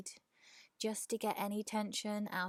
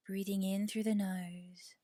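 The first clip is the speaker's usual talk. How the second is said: guided meditation. Real, not acted. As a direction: ease them into rest, unhurried and measured. Leads a yoga nidra meditation.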